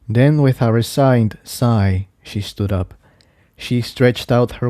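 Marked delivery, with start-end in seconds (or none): none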